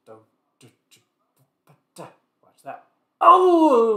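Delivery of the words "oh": surprised. Real, not acted. Sudden exclamation.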